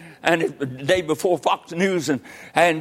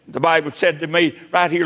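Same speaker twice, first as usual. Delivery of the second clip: ordinary talk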